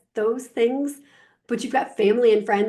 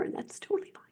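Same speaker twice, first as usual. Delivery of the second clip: quick confiding whisper; whispered aside; 12 dB quieter, faster than usual. Real, not acted. Coach hedging a touchy point to viewers, quick whisper. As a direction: rush it under your breath. Hushed aside about gender.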